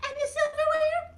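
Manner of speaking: high puppet chime-in; Mimi. Acted. Mimi adds to the table setting, high puppet voice. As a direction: pipe up in a tiny, high character voice.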